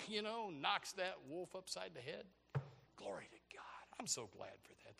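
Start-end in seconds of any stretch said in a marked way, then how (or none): 2.9-5.0 low, whispered thanks; hushed praise. Real, not acted. Preacher thanking God for the shepherd, hushed whisper. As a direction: drop to a grateful whisper.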